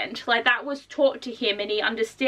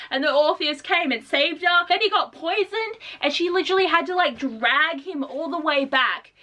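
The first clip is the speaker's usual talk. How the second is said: ordinary talk, nothing marked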